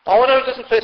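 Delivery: ordinary talk, nothing marked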